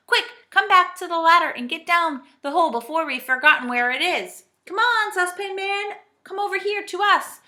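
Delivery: high, loud warning; urgent calling. A narrator's reading. Moonface warns of the lost hole, calling urgently. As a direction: call them back in a hurry.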